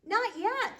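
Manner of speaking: high, loud urgent cry; exclaiming. Acted. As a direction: stop them with a sudden high cry. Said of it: "Beatrice the butter maid exclaimed".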